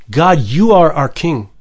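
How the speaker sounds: ordinary delivery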